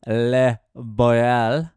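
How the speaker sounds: usual speaking voice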